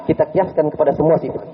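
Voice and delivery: normal talking voice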